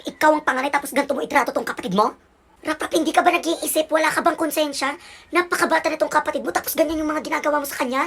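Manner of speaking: indignant, loud lecture from a mother; angry scolding. Acted. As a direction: berate the older child. Mother scolding older son.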